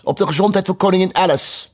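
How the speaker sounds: high, loud toast to the hall. Acted. Red queen toasting queen Alice to all the guests, loud. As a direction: raise the toast loudly. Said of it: "riep ze luidkeels".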